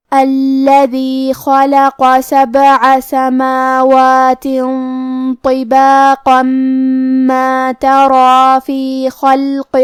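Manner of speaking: very slow, melodic tajweed chant; Quranic recitation. Acted. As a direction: recite the verses with drawn-out melody. Recites verses after explaining rules.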